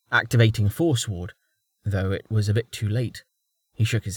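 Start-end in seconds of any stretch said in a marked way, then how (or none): none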